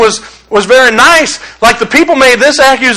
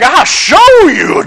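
mock threat; 6 semitones higher, much slower than usual. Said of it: "I was like".

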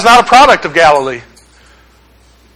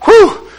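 mock relieved whew; 11 semitones higher than usual. Mock relief after sarcasm.

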